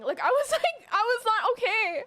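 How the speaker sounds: high, upset voice close to crying; tearful. Real, not acted. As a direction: pitch it high, choked up at the memory. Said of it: "I was crying so hard".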